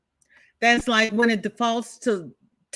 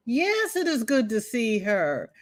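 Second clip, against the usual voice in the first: ordinary delivery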